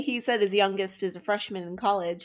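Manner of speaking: ordinary talk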